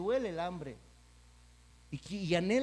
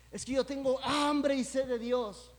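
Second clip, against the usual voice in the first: higher and louder, pushing the claim; mock insistent plea; 6 semitones higher, 4 dB louder than usual. People repeating to the preacher they hunger for God, higher mock insistence. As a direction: insist on hambre as if to convince. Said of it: "que dicen".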